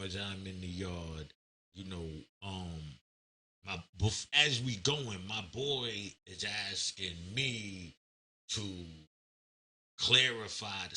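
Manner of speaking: ordinary delivery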